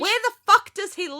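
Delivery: loud, high, baffled demand; exasperated outburst. Real, not acted. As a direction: demand an answer from the screen. Exasperated at the TV plot.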